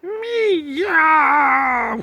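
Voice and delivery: long, very high cat scream. Acted. Cats screaming at the slimy sea. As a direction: shriek like terrified cats. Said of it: "screamed the cats".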